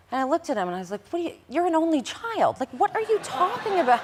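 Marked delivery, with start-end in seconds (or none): 1.1-4.0 high, incredulous retort with a laugh; you can't believe what you just heard, laugh it off; incredulous disbelief. Retold or imitated. Incredulous retort to boyfriend's lie.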